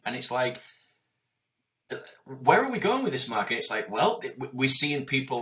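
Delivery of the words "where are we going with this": ordinary talk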